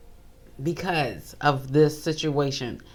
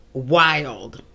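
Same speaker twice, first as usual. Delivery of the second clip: ordinary talk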